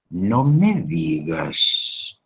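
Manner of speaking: very slow, flat-toned, begrudging no me digas; grudging, envious. Shown as a demonstration. Envious friend hears Margarita bought a car, grudging. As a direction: say it with hidden resentment. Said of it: "está diciendo todo lo contrario".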